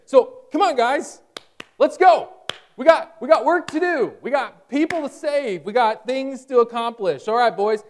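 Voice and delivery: high, loud rallying of the crew; loud excited team call. Acted. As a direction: fire them up to get moving. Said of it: "it's a very emphatic kind of explosion of, of language".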